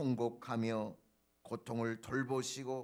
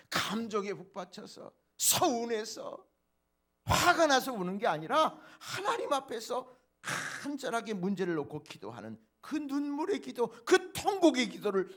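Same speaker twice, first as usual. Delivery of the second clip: louder, pressing each phrase; fervent preaching; 9 semitones higher, 8 dB louder than usual. Acted. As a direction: urge the congregation with passion. Preacher stressing tearful prayer. (힘주어 외치는 설교)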